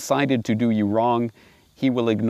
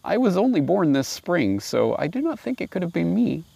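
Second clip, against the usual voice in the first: lightly raised polite lamb voice; mildly higher, meek little lamb; 8 semitones higher than usual. Acted. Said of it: "replied very politely".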